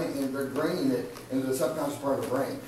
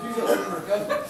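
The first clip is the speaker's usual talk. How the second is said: usual speaking voice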